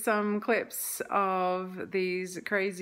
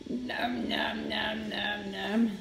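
whispered nom nom; much slower than usual. Pretend. Pretends to eat.

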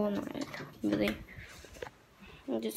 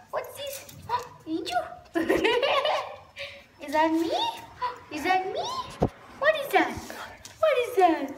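playful pet talk; 12 semitones higher than usual. Real, not acted. Girl playing with her dog, high gasping baby talk. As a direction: tease the dog with squeaky-high questions.

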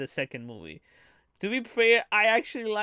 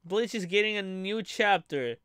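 ordinary talk

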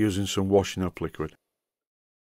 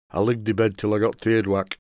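imitating a grandfather's broad Yorkshire dialect; hard-to-understand broad Yorkshire, words run together; broad Yorkshire accent. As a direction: quote grandad in thick Yorkshire. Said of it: "My grandfather had a really broad Yorkshire accent".

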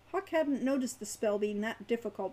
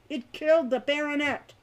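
loud, higher angry protest; heated protest; 5 semitones higher, 8 dB louder than usual. A narrator's reading. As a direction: push back hotly. Hawk argues heatedly.

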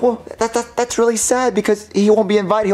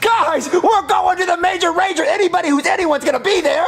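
high, fast, excited rallying; giddy party announcement; hyped up; 9 semitones higher than usual. Acted. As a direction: pump up your friends. Hyping the party.

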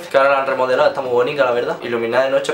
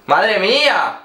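exasperated; 6 semitones higher, much slower than usual. Real, not acted. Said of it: "No se podía haber puesto".

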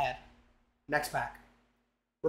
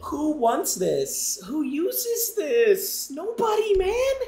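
mocking scorn; 9 semitones higher, much slower than usual. Real, not acted. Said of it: "mocking the item".

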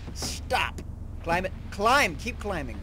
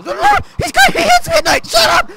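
very high loud shrieking; shrieking joke; 13 dB louder, slower than usual. Pretend. Youtuber shrieking a joke at the end, laughing and loud.

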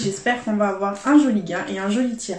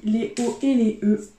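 ordinary talk, nothing marked

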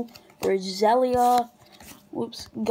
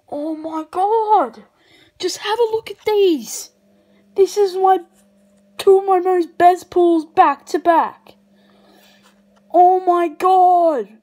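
high, loud, real excitement; thrilled amazement; 7 semitones higher, 8 dB louder than usual. Real, not acted. Collector showing off two best pulls back to back, high thrilled amazement. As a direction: voice raised and bursting with disbelief.

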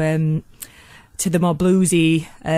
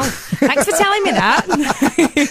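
laughing mock thanks; 6 semitones higher, much slower than usual. Real, not acted. Teased about the famous studio.

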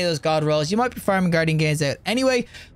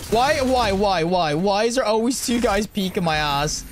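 exasperated complaint; slower than usual. Real, not acted. Player caught by two enemies, exasperated slow whine. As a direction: throw your hands up at the game.

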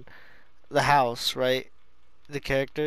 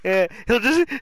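normal talking voice

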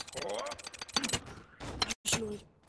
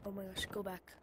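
normal talking voice

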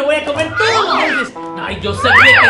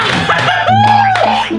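loud high gleeful laugh; triumphant laugh; 4 dB louder than usual. Acted. Triumphant laugh, found solution.

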